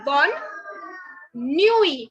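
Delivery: bonne nuit with the final T silent, "nwee"; French bonne nuit. Shown as a demonstration. Teacher modelling bonne nuit for the class, clear and slow. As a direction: say bonne nuit slowly, dropping the last T. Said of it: "don't say good night, but say".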